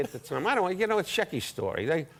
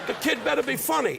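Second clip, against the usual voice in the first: higher wiseguy voice; armed gangster; 8 semitones higher than usual. Retold or imitated. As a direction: lightly play a mobster in the audience. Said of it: "they all had the little sling guns".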